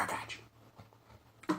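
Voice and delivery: soft whispered reassurance. Real, not acted. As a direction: breathe it, slow and unvoiced.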